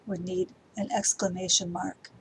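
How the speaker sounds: ordinary delivery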